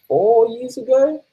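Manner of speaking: slow, very high squeak; high squeaky voice. Pretend.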